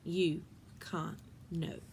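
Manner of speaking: hushed and slow; barely above a breath, slow; softly, almost whispering. Acted. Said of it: "it was almost a whisper".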